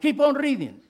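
loud, high repeated urging; urgent urging. Real, not acted. Pastor urging a sleepy Bible reader, loud and high. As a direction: push them to keep going.

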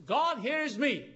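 high, loud, drawn-out plea; sinner calling to God. Acted. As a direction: cry out to God, slowly. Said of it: "turn to Jesus and say".

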